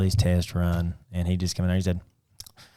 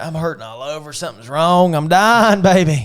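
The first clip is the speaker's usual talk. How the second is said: mock dying; 6 semitones higher, 4 dB louder than usual. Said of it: "I worry about stupid stuff".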